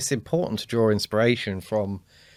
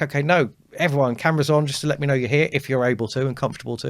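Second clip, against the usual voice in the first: higher, louder call to the online room; brisk, raised-pitch request to everyone on the call; chirpy meeting host; 6 semitones higher, 5 dB louder, faster than usual. Acted. Presenter asking attendees to switch cameras on, chirpy meeting host.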